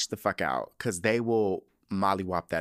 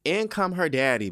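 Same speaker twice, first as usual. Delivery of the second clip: usual speaking voice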